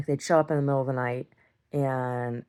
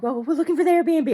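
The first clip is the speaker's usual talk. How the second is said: much higher, prissy mimic; mocking visitor voice; 14 semitones higher, 5 dB louder than usual. Telling how the Airbnb guests answered, high mocking voice. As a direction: mimic them high and condescending.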